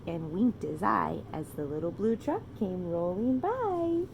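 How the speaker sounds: lilting, slow picture-book reading; reading to children. A narrator's reading. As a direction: read it like a bedtime story to a toddler.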